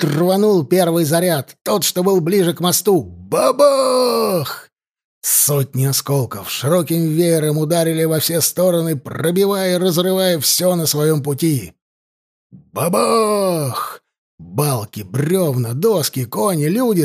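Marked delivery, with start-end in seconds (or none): 3.0-4.7 high, drawn-out explosion word; booming blast. A narrator's reading. Narrator imitates the first bridge charge going off, booming. 12.5-14.0 long, raised explosion word; booming blast. A narrator's reading. Narrator imitates the next charge blowing the bridge, booming.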